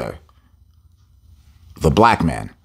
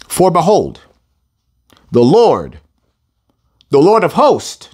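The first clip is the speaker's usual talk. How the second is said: preaching read; 6 semitones higher than usual. Real, not acted. Preacher booming scripture to the congregation. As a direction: proclaim the verse with force.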